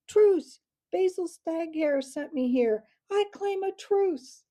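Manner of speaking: urgent high-pitched shout; yelling at full voice. A narrator's reading. Mouse under attack pleads with the owl, yelling. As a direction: yell for a truce at the top of your voice. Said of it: "yelled at the top of his voice".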